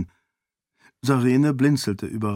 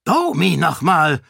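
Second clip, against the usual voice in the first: an outraged king swearing; furious oath; 7 semitones higher, 5 dB louder than usual. Acted. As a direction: curse it out, louder and higher. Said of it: "rief er und stieß einen Fluch aus". (wütender Fluch, laut)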